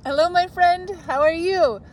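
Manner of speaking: ordinary talk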